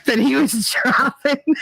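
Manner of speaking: breaking into high giggles mid-phrase; laughing while talking; real laughter. Real, not acted. Cracks up at edited-out words.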